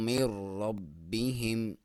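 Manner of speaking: من ربہم with noon merged into ra, no nasal; slow recitation. Shown as a demonstration. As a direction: chant it slowly, merging the noon. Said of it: "با کو تھوڑا دبا کے پڑھیں گے".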